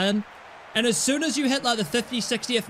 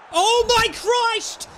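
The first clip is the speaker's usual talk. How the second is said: exasperated cry; 12 semitones higher, 4 dB louder, much slower than usual. Real, not acted. Bad touch, exasperated cry.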